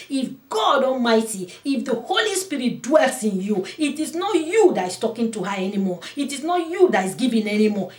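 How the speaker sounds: loud, high sermon exhortation; impassioned preacher; full-force preaching. Acted. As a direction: urge the congregation on.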